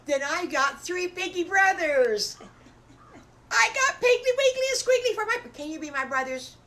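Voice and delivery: very high, loud excited squeal; shouted, high-pitched delight; thrilled little wolf. Acted. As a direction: burst out with childlike joy. Wolf thrilled at gaining brothers.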